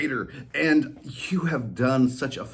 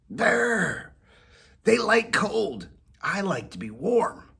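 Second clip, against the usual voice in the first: higher cat voice with a brr; shivering cat; 11 semitones higher, slower than usual. Acted. Whiskers shivering at the thought, higher cat voice. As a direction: shiver through it. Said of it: "Whiskers shivered".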